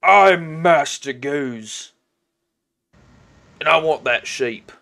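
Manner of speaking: slow, lightly voiced orc boss; a touch of a menacing orc; demanding orc. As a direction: announce yourself slowly and state your demand. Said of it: "and he says".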